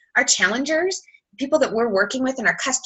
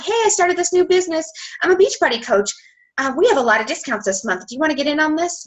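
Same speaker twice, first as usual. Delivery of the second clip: higher-pitched, peppy mock sales pitch; eager sales pitch; 7 semitones higher than usual. New coach messaging strangers about discounts, mock sales pitch. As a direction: imitate an over-keen new coach pitching.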